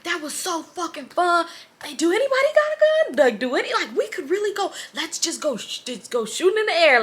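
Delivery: high, excitable imitation; hyped-up friend. Drunk friend urging the car to go shooting, high and excited. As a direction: mimic a giddy friend egging everyone on.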